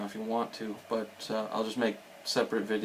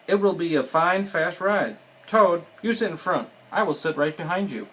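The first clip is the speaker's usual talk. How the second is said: animated storybook reading, louder for the dialogue; reading to children; 3 semitones higher, 5 dB louder than usual. A narrator's reading. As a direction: read it lively, lifting the characters' lines.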